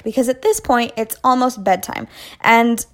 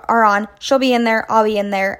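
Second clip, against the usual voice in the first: ordinary delivery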